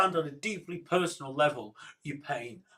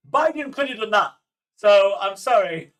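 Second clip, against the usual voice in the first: high, loud amused disbelief; incredulous excitement; 5 semitones higher, 7 dB louder than usual. Real, not acted. Streamer marvelling to chat at Bush's golf remark, high and loud. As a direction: exclaim it while still laughing. Laughing disbelief at Bush clip.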